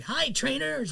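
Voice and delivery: high-pitched cartoon hero greeting; Ash Ketchum. Fan retells hearing the voice actor in line, high imitated cartoon voice. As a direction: imitate an upbeat anime kid, pitched well up. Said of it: "you hear Ash Ketchum's voice".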